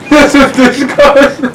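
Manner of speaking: disgusted giggle; laughing disgust. Real, not acted. Reacting to gross taste, laughing.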